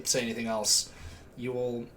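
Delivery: ordinary talk, nothing marked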